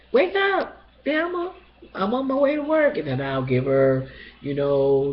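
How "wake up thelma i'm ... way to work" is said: high, bright sing-song wake-up call; cheery wake-up call. Retold or imitated. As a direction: call out to rouse a sleepy friend. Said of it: "and I would, like".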